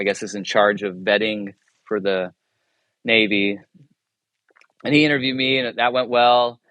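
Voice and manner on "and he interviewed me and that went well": normal talking voice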